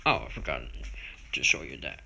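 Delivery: usual speaking voice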